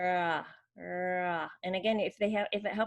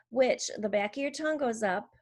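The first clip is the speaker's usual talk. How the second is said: normal talking voice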